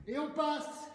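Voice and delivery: normal talking voice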